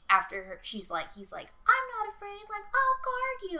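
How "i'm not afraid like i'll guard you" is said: very high mock child voice; little kid voice. Little brother offers to guard his sister, kid voice. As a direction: squeak it out as an imitated small boy, slowing at the end.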